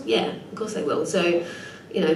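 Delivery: ordinary talk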